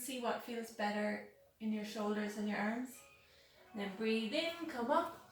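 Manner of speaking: normal talking voice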